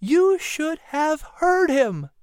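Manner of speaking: high, drawn-out woman's delight; happy exclamation. A narrator's reading. Sarah tells the men about the sermon, happily exclaiming. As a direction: exclaim it slowly, pitched well up. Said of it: "Sarah exclaimed happily".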